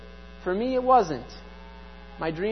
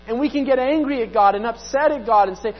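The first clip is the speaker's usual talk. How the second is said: ordinary talk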